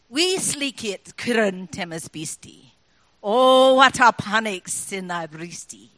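Burns read in broad Scots, slow and rolling; Scots poem recital. Pretend. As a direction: recite it like a Burns Supper toast. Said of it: "it goes like this".

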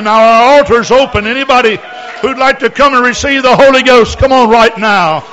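loud, high fervent altar call; preaching altar call. Real, not acted. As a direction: urge them forward with full force. Altar call at full force.

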